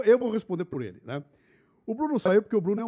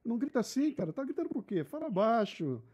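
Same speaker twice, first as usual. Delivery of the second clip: lightly voiced calm peacemaker, higher pitched; gently mock-pleading to cool things down, pitched up; raised pitch, restrained volume, playful imitation of a conciliator; imitating Bruno; 6 semitones higher, 3 dB quieter than usual. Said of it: "O Bruno é o cara que".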